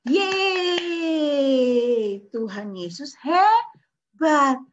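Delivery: loud high cheer; joyful cheer. Acted. Children cheer the miracle, loud and joyful. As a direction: cheer it out with delight.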